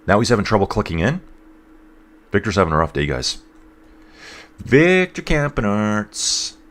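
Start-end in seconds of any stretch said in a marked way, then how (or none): 4.6-6.6 high, slow announcer-style shout-out; drawn-out name call. Real, not acted. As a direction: stretch the name out like a ring announcer. Calling out the rider's name.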